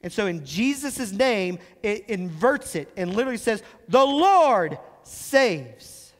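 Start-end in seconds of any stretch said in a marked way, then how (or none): 3.9-6.1 THE LORD drawn out high and loud, then saves; very slow, ringing proclamation of "The Lord saves"; emphatic. Shown as a demonstration. Preacher explaining the meaning of Jesus' name, emphatic. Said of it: "his name was emphatic".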